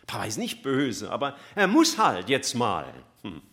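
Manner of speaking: ordinary talk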